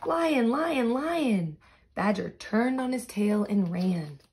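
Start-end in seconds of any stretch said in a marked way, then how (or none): 0.0-1.6 high panicked cry of lion; gasp, then shout lion in fright; alarmed cry. Acted. Badger sees the lion.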